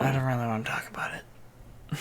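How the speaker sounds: hushed, voiceless aside; whisper. Real, not acted. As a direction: whisper it as if embarrassed.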